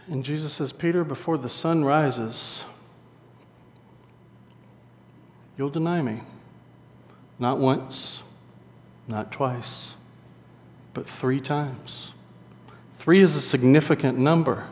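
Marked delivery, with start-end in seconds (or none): 0.7-12.1 hushed, slow and measured; grave prediction. Retold or imitated. Jesus foretells his friend's denial at supper, hushed and grave. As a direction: say it very softly with weighty pauses.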